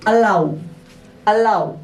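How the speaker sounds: अलाउ with a clear drawn ow ending; sounding out the w ending. Shown as a demonstration. Teacher demonstrating the w ending to the class. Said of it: "डब्ल्यू का उच्चारण हो जाएगा ऊ या ओ".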